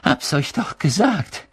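high, gasped reproach; panting. A narrator's reading. Hermine repeating her reproach after running, panting. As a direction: catch your breath between words. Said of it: "keuchte Hermine".

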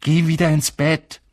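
angry Ron. A narrator's reading. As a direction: send her off to bed furiously. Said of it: "sagte Ron zornig".

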